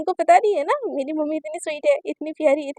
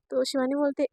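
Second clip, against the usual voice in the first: usual speaking voice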